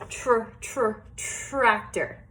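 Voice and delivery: "Tr, tr" repeated, then "tractor"; sounding out. Shown as a demonstration. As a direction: break "tractor" down at the start. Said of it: "this last one here is a tractor".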